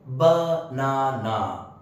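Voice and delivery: banana said slowly as ba-na-na; slow, evenly split banana; syllable by syllable. Shown as a demonstration. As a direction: stretch banana into three separate beats. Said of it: "this word has three syllables".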